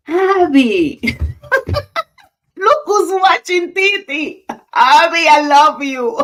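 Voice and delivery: loud, high squeal of affection with a laugh; delighted excitement. Real, not acted. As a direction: call out to a beloved niece, thrilled. Spots her niece watching the live.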